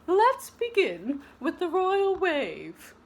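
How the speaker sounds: a grand welcome to the ladies; prim teacher. Acted. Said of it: "said Madame Gertrude".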